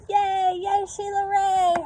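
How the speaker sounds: high, loud cheer; cheering yell. A narrator's reading. Friends cheering Sheila Ray, high loud yell. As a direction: cheer her on at the top of your voice. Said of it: "her friends yelled".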